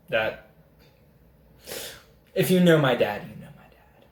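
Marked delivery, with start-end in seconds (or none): 3.2-4.0 barely voiced, very soft aside; knowing mutter. Real, not acted. Storyteller hinting at dad's reputation, knowing mutter. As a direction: drop it almost to a whisper.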